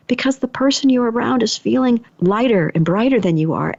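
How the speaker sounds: ordinary delivery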